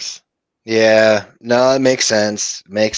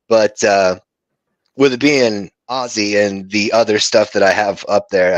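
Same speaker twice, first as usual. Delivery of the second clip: normal talking voice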